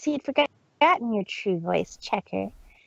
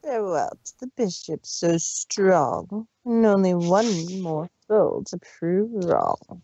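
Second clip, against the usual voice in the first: low, slow menacing rhyme; sinister villain; 7 semitones lower than usual. Acted. Villain gloating over the fallen heroes, low and slow. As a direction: intone it like a dark spell.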